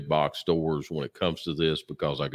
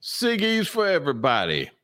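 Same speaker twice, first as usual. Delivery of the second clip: playful goofy voice; 11 semitones higher, slower than usual. Pretend. Playful cigar nickname, voice put on.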